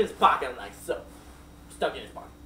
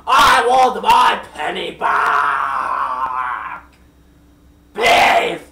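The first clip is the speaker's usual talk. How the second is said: slow, much louder hammed-up yell with a cry in it; angry shout; 14 dB louder, much slower than usual. The king of the Japeths demands his penny back, in a hammed-up slow yell. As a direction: bellow the demand like a cartoon villain. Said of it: "he was like".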